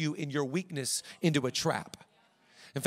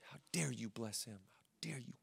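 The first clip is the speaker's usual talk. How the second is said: furious words in a whisper; angry whisper; 6 semitones lower, 13 dB quieter than usual. Acted. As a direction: seethe it in a breathy whisper. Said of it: "He's so mad".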